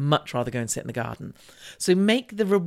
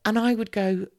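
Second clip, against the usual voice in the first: normal talking voice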